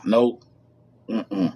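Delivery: ordinary talk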